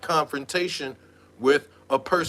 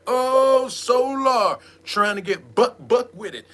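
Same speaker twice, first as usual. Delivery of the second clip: much higher, louder amazed exclaim; mock surprise; 8 semitones higher, 4 dB louder than usual. Mocking Solar trying to stand up to her. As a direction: gasp it in delight. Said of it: "I was like".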